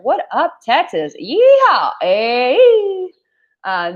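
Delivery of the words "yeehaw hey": much higher, louder cowboy whoop; cowboy yeehaw. Pretend. Cheering her Texas viewers with a cowboy whoop.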